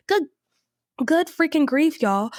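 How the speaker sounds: exasperated. Real, not acted. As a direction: throw it out in exasperation. Exasperated outburst.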